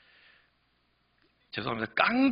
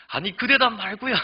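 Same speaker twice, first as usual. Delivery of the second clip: raised, louder objection, half-laughing; hammed-up complaint to God; mock protest; 7 semitones higher, 5 dB louder than usual. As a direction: push back like a stubborn child. Mock protest at God's answer.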